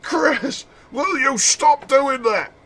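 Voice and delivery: high mock yells of Chris from the game heroine; imitating Sheva yelling. Annoyed partner yelling for Chris to come back. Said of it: "She yells that at night".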